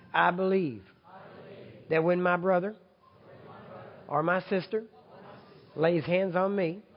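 full-voiced, earnest group prayer; prayer said like you mean it. Real, not acted. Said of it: "say it like you mean it".

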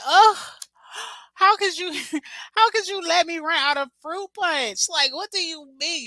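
high, breathless mock outrage; hammed-up drama. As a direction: wail it like a melodramatic betrayed wife. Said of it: "Y'all, I be so dramatic".

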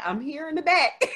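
amused, lightly imitated reply; laughing quote. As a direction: retell his line while laughing. Brother's reply, retold laughing.

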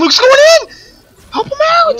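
very high, loud, frantic yell; urgent shout. Real, not acted. Player calling team to help Luke, urgent shout. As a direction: scream for backup at top pitch.

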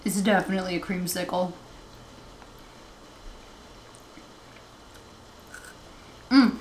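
savouring a bite; chewing while talking. Real, not acted. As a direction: mouth partly full. Seen 0: Woman speaks holding bitten wafer piece, eyes closed, savouring mid-chew.

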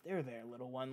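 quiet, low, imitating a villain's false comfort; mock soothing. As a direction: hush it low, as if calming a child. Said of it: "stroking her hair and is like".

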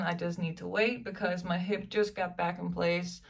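ordinary talk, nothing marked

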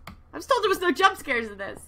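high, loud protest; rattled, aggrieved outburst; indignant complaint. Real, not acted. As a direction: protest like someone just tricked into a scare. Complains after jump scare.

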